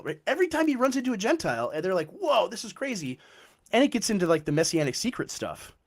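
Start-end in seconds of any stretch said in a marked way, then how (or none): 2.1-3.2 mock-astonished, drawn-out and high; retold amazement. Amazed Gentiles reacting to Jesus, astonished. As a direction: marvel in the voice of an amazed onlooker.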